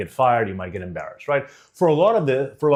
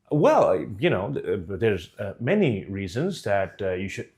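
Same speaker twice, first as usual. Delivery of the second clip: hesitant, stumbling answer, low and softer; deer in headlights; 6 semitones lower, 3 dB quieter than usual. Acted. Candidate caught off guard by interview question, hesitant and shrinking. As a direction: stall with fillers, losing all conviction. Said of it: "deer in headlights look".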